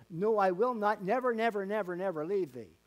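each "never" hammered, rising then falling; repeated emphasis. Shown as a demonstration. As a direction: stack the nevers, pressing each harder. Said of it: "What it really is saying".

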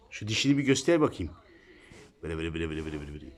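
hushed, soft voice to a pet; soft talk to the cat. Real, not acted. Owner quietly pointing out her cat's looks, softly. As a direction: murmur gently so the cat stays calm.

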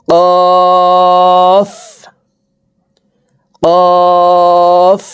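"কফ" said thick from the back of the tongue, held long on a high level tone; heavy, stretched qaf. Shown as a demonstration. Teacher demonstrating qaf, long heavy chanted hold. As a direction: draw out a heavy "কফ" as a steady note. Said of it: "কফ হরফও মোটা করে উচ্চারণ করব".

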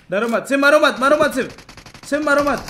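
loud, high repeated urging; rally the squad, loud and high; urgent calling out. Real, not acted. Gamer urging teammates to stay alive, urgent calling out.

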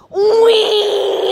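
high joyful "wee!" yell; wee scream. Retold or imitated. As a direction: shout it like falling from a tree. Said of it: "on the way down I went".

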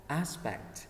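aspect with stress on AS; stress first syllable. Shown as a demonstration. Teacher drills first-syllable stress in aspect. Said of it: "mình sẽ nhấn ngay âm, âm một".

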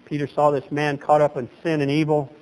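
usual speaking voice

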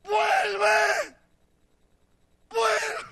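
high, drawn-out cry to come back; desperate shout. Acted. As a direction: shout it out after a lost love. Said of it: "Oseas sale y grita". (grito desesperado y agudo)

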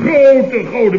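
sudden high loud burst; outburst. Acted. Boss correcting Paul, sudden loud outburst.

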